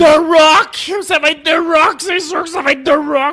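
high, loud excited yell; excited shout. Real, not acted. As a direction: hype it up, insisting. Insisting on wrestling pick.

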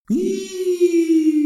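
high, far-off drawn-out cry; a ghost keening from far away; a spirit's high eerie call across a dead planet; eerie ghostly wail. Acted. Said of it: "said a voice".

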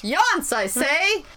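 high, loud hammed-up town-crier call; mock proclamation. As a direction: announce it like a mock herald. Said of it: "it sounded like he was making a proclamation".

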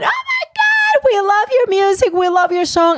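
put-on high, excited squealing fan girls; imitating tipsy girls shrieking with delight; screaming fans. Girls spotting her in Vegas, squealing excitedly. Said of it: "But I'm talking, screaming".

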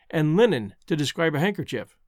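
ordinary delivery

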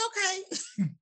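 a high, soft, hesitant okay; reluctant okay. Retold or imitated. Agreeing to a gun range trip, high and hesitant. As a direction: agree while secretly unsure.

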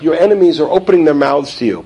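ordinary delivery

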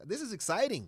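contained excitement; retold excitement. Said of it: "I was excited".

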